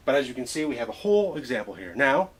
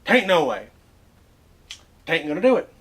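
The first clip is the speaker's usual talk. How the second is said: Western drawl; 5 semitones higher, 7 dB louder than usual. Shown as a demonstration. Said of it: "there are is not".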